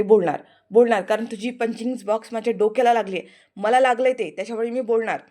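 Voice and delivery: loud, stubborn repeat; loud defiant comeback; defiant insisting. Acted. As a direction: refuse to be silenced.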